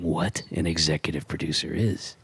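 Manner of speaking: low, booming radio-announcer voice; deep announcer voice. Acted. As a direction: play the big show announcer. Deep announcer bit for sponsors.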